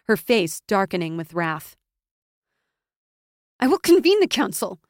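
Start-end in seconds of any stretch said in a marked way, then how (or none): 3.6-4.8 high, loud and frantic; a shrill desperate cry. A narrator's reading. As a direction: clutch at control in panic. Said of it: "Krissa said desperately".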